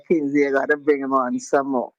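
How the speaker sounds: ordinary talk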